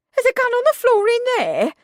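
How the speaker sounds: high, loud, fast alarmed cry; aghast. A narrator's reading. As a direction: gasp it out in alarm.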